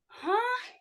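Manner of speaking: high stunned huh; a high, breathy gasp of disbelief; shocked huh. Retold or imitated. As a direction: caught completely off guard. Shocked at being spat on.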